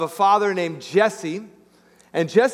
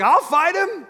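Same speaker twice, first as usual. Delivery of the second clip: much higher, bold and eager; eager volunteer; 11 semitones higher, slower than usual. Retold or imitated. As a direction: jump at the chance: I'll fight him. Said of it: "And David's like".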